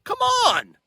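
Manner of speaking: high squeaky "come on"; exaggerated disbelief; mock outrage.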